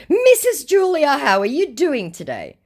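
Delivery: high, loud mock scammer greeting; fake Jimmy Bernas. Scammer greets his target, overdone high voice. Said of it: "reintroduce you all to Jimmy Bernas".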